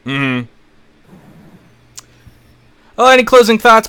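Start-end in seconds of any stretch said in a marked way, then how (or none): none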